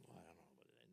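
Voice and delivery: very soft, murmured doubt; hushed aside. Retold or imitated. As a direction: whisper it to the group. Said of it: "then they'd whisper a little bit more".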